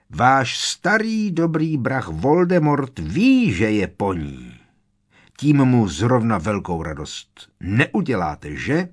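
mocking taunt. A narrator's reading. Harry mocking Bellatrix, laughing madly.

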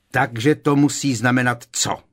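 furious interruption; open fury. A narrator's reading. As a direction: spit the question back angrily. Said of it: "vyprskl Kreb na Malfoje s netajeným vztekem".

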